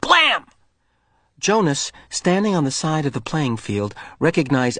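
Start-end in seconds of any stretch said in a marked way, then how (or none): 0.0-0.4 high punchy blam; boy firing imaginary weapon; play gunshot. Acted. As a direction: fire a pretend shot. Play gunfire.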